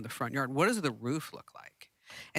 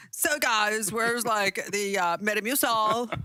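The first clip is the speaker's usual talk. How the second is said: higher, louder mock influencer voice as an old man; aging youtuber; 5 semitones higher, 3 dB louder, slower than usual. Cohost mocking a grown-up youtuber hunting for Metamucil. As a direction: ham up a sixty-year-old streamer. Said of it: "they'll be like, yeah, like, sixty years old".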